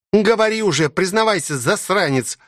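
high-pitched, impatient princess demand; growled demand. A narrator's reading. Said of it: "прорычала она".

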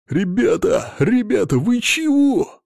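very high squeaky hamster cry; near-ultrasonic wail. A narrator's reading. Hamster pleads with his dazed comrades, squealing. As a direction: climb to a drawn-out squeal on the last words. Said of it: "Срываясь чуть ли не на ультразвук, вопил саланганец".

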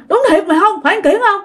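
high-pitched nervous checking; scared, doubting questions. Acted. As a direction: ask warily whether it's really him. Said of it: "Còn giờ ông sợ".

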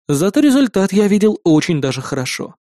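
plain reading voice

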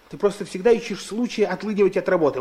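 ordinary talk

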